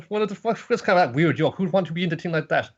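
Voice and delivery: bratty cartoon teen sneer; mocking friend. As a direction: ridicule the doll.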